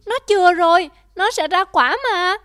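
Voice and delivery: high, loud frantic pleading; frantic begging. Acted. Daughter pleading with her father to spare the tree, frantic. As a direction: cry out and beg him to spare it.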